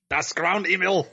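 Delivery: slow, high German trailer voice; mock German accent. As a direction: boom it like a German announcer. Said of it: "like the German trailer".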